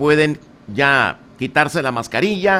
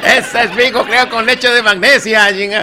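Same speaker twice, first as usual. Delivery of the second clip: words through laughter; laughing; 4 semitones higher, 3 dB louder than usual. Real, not acted. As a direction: quote the punchline laughing. Repeating punchline while laughing.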